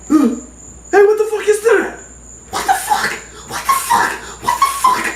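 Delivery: very high squealed panic; freaking out. Retold or imitated. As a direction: shriek in hammed-up terror, voice cracking into falsetto. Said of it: "I start freaking out".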